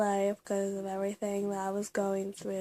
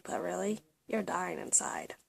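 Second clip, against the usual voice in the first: soft, hushed whisper, much softer; whispered aside; 4 semitones lower, 10 dB quieter than usual. Acted. Confessing to viewers that she's dying inside, whispered. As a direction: let the truth slip out in a whisper. Dramatic aside after pretending happy.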